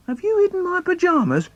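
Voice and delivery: put-on high fast kid's accusation; boy accuses his brother, squeaky and quick; squeaky child voice. Brothers racing to bed.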